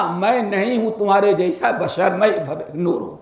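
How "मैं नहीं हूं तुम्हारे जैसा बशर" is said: ordinary talk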